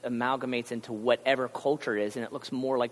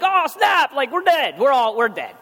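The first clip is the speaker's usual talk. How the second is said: much higher, louder panicked outburst; mock panic; 12 semitones higher, 10 dB louder than usual. Sailors realizing Jonah's God means doom, hammed-up panic. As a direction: blurt it out like doom just landed.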